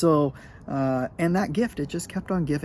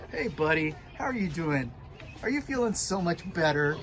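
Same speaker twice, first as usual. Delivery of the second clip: soft pet talk; 5 dB quieter than usual. Real, not acted. Talking to his recovering dog.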